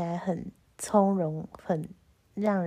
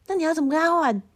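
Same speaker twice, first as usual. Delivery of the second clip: put-on boyfriend pressing; 7 semitones higher, 3 dB louder, much faster than usual. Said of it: "然后他说".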